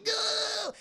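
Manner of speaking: very high terrified cry; mock panic. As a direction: squeal like kids who saw a ghost. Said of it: "they freak out and they run away".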